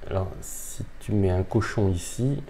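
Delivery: ordinary talk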